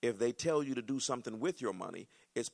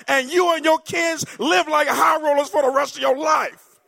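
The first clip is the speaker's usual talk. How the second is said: much higher, much louder shout; full-force preaching; 20 dB louder than usual. Real, not acted. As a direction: preach it at the top of your lungs. Preacher's climactic loud retelling.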